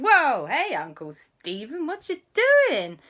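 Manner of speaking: high, loud, delighted surprise; excited greeting. Acted. Auntie answers uncle's surprise FaceTime, excited. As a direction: greet an unexpected caller excitedly.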